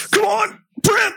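very high, stretched-out squeal; hammed-up pleading at the printer, slow and high; mock desperate urging. Boosters frantic to print firing papers.

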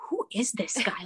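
hushed worried whisper. Retold or imitated. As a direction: whisper it nervously. Panicked whisper to friend.

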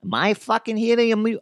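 high, tough Italian-American wiseguy rant; Joe Pesci impression. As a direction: play an offended little mobster, mock-angry. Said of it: "I can't do Joe Pesci".

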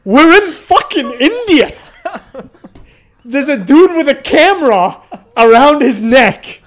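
high, loud exasperated outburst. Real, not acted. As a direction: blow up at the absurdity, pitched high.

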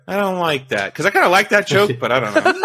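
imitated exasperated explainer; mock exasperation. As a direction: drag out a put-on complaint. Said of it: "explains the joke in an exasperated manner".

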